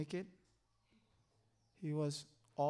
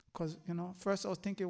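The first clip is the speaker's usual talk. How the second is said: ordinary talk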